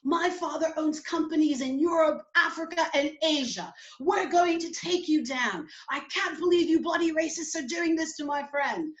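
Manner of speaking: high, indignant British tirade; Heidi. Retold or imitated. British girlfriend threatening the police station, outraged British accent. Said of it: "Heidi's like".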